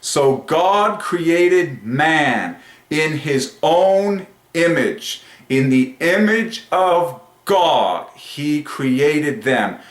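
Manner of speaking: stretched-out triumphant preaching. Real, not acted. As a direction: draw out every word as grand scripture. Proclaims conclusion and scripture.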